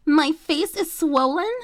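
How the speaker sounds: high, loud, slow horrified question; shocked outrage. A narrator's reading. Chloe horrified to hear her face is swollen, high and loud. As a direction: gasp it out in outrage.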